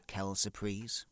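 ordinary talk

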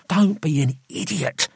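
high, tense scolding; sharp rebuke. A narrator's reading. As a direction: cut him off in exasperation. Gregory rebukes panicking Roy.

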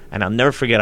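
normal talking voice

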